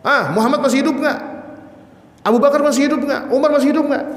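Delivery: high-pitched yelled questions; mock battlefield yell, pitched well up; imitated shout. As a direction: call out high, challenging the other side. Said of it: "itu sambil teriak, Pak".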